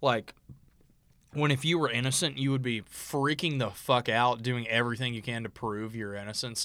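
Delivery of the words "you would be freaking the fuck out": ordinary talk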